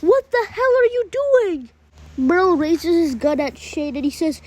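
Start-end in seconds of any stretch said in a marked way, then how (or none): 0.0-1.7 high-pitched yelled challenge; outraged yell. A narrator's reading. Merle at Shane after he shot the governor, outraged yell. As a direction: yell it in disbelief at an ally. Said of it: "Merle yells at him".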